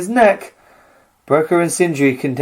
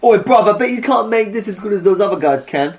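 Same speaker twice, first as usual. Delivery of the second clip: chav voice; 7 semitones higher than usual. Pretend. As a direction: taunt like a cheeky chav. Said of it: "like a chavvy".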